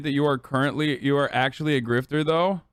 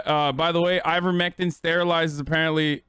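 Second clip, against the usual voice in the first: ordinary talk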